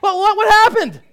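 high, loud mock kid voice; hammed-up, insistent questioning; alarmed child. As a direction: blurt it out like a worried child. Acting out kids questioning weeping dad.

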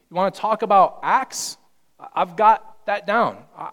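loud, emphatic boasting sermon; fired-up preacher boasting as Paul; preaching at full force. Acted. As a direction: hammer each boast home.

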